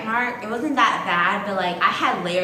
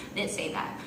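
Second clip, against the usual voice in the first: whispered aside; 3 semitones lower, 10 dB quieter, much faster than usual. Real, not acted.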